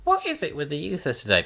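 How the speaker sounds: a touch of a grumbling elder, quick; grumpy oldie complaint. Retold or imitated. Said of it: "when I say".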